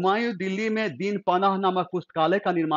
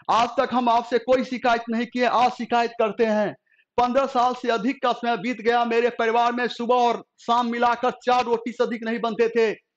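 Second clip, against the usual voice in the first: raised, pleading outcry from the queen; a wife crying out her grievance to the emperor, voice raised high; 6 semitones higher, 3 dB louder than usual. A narrator's reading. Queen reproaching her husband, raised voice. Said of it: "चीखते हुए बोली".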